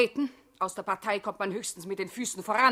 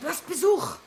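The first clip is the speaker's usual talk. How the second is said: high calling voice; 11 semitones higher, 4 dB louder than usual. Acted. Waking Hugo to announce a visitor, calling. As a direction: call it to rouse him.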